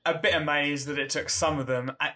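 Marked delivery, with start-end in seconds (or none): none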